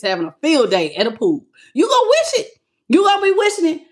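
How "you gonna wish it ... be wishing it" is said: high teasing taunt. Acted. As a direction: rub it in, sing-song. Taunting the doubter.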